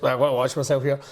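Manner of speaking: ordinary delivery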